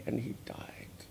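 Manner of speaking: whispered, full of grief; grieving whisper. Real, not acted. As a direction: let it die to a breath. Grief at friend's death.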